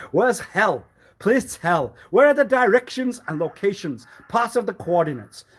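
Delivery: loud mock demand. Mocking rant about finding hell.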